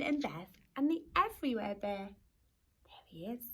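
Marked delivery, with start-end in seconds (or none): 2.1-3.4 whisper. Acted. Aside revealing the bear.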